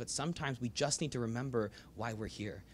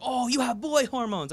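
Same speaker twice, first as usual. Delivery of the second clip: high, loud mock granny voice; hammed-up grandma stating her science; mock grandmother; 13 semitones higher, 10 dB louder than usual. Imitating grandmother explaining boy hormones, high and hammed-up.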